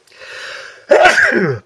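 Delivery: sudden loud sneeze; a real sneeze bursting out. Real, not acted. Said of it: "About to sneeze".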